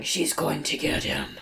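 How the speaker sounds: slow, whispered monster growl; deep gravelly voice. Retold or imitated. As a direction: creep it out slowly to scare a kid. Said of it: "scares him with a deep, gravelly voice".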